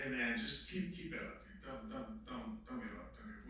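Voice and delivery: soft, low, repeated urging; quiet encouragement. Retold or imitated. Retells encouraging his friend Aaron.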